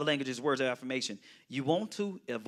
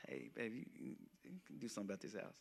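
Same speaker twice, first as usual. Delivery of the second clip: much softer, quick sweet-talk to the wife; sweet hushed request; 8 semitones lower, 21 dB quieter, faster than usual. Shown as a demonstration. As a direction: coax her gently, low and soft. Sweet-talking spouse about chores.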